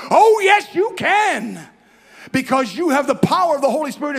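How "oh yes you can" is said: high, loud triumphant retort; preacher refuting depression, shouting; emphatic shout. Acted. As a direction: shout it back against the doubt. Preacher answers doubters.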